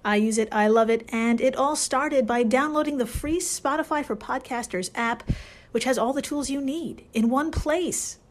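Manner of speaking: upbeat, loud sponsor read. Acted. Host reading a Spotify ad to listeners, upbeat ad read. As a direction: sell the app with a bright pitch.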